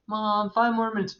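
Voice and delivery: lightly voiced child bargaining with mom; kid begging. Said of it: "Like the kid said".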